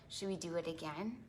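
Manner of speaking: normal talking voice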